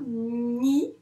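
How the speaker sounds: "Ni" with a long hummed n before i; slow teacher's "Ni"; sounds stretched. Shown as a demonstration. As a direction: draw out "Ni" so both sounds show. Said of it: "on entend quoi?".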